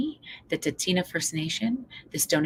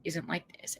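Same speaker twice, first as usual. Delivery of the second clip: normal talking voice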